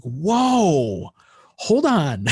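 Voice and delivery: high, loud startled stop; startled whoa. Retold or imitated. Recalling his reaction to the bandleader, startled. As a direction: pull up short in alarm.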